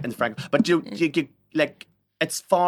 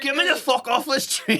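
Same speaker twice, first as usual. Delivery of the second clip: higher, pleading mock cry; mock desperate plea; 11 semitones higher than usual. Pretending to be the visitor desperate to escape the smell, higher. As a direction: beg to be let out, half joking.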